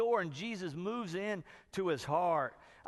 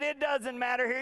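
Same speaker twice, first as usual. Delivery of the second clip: high, loud mock-panicked shout; frantic protest; 8 semitones higher, 5 dB louder than usual. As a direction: shout it down in exaggerated desperation. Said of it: "one guy is just frantic".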